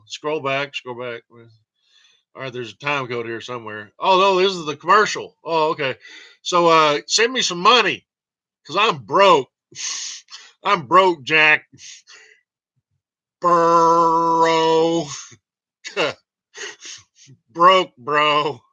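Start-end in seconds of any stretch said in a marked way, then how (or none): 4.0-11.6 louder, higher hammed-up plea; mock begging. To viewers, hammed-up loud plea for money. 13.4-18.6 long, loud, higher bro; drawn-out bro. Pretend. To viewers, joking loudly that he's broke.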